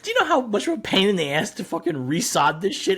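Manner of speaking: loud, heated coach rant; angry rant. Retold or imitated. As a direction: vent about the torn-up field. Coach ranting about ruined field.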